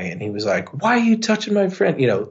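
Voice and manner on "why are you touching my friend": imitating a feisty friend, much higher pitch; feisty tiny guy. Tiny bandmate challenges massive bouncer, feisty. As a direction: challenge them in a high, scrappy voice. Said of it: "a really feisty, tiny guy".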